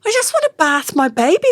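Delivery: pleading. Retold or imitated. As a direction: plead to bath your own baby. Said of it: "And I'm going".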